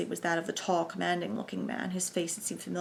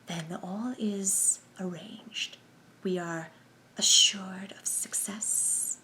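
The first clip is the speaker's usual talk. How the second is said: slow, hushed hissing question; slow sibilant whisper; much slower than usual. A narrator's reading. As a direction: whisper slowly and hiss the s sounds. Number one checks arrangements.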